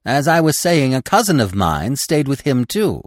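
high, loud chattering storyteller; excited, high-pitched animal gossip; chattering squirrel. A narrator's reading. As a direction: chatter away eagerly as a squirrel. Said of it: "the squirrel began to chatter again".

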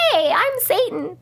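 a cheery, unscary Satan; mock Satan. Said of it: "scary talk voice".

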